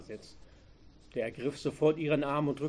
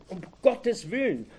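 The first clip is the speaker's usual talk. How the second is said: a touch of alarm, higher and louder; frightened cry; 5 semitones higher, 5 dB louder than usual. A narrator's reading. Young teller seeing the gunman, mild frightened cry. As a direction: cry out in shock. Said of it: "rief die junge Frau".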